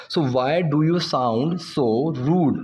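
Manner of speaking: slow, deliberate example sentence; slow teaching demo. Shown as a demonstration. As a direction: lay each word out slowly. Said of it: "वो वाली बात कि". (धीरे-धीरे समझाते हुए)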